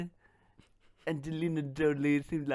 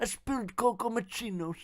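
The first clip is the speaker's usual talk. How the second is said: Johnny Depp voice; 5 semitones higher, 3 dB quieter than usual. Pretend. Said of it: "my Johnny Depp accent".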